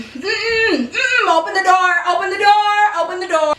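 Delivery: high, insistent repeated demand; stubborn demand. Retold or imitated. Acting out stubborn refusal to calm down.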